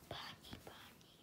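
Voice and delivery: unvoiced creepy whisper of bunny. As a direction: breathe the name like a ghost. Eerie whispered repetition.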